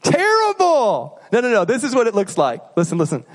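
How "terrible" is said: a slow, dismayed verdict; drawn-out disgust. Real, not acted. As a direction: stretch it out slowly, up high. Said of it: "drawn-out verdict on bad apology".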